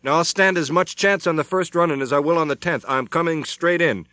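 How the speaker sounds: slow, high, loud outburst; desperate. A narrator's reading. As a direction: make them hear it, desperate. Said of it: "his voice shaking slightly".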